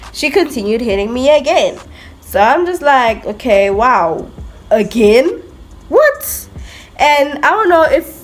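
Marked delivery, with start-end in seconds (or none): 3.3-6.5 disbelief. Retold or imitated. Hit again by the same girl.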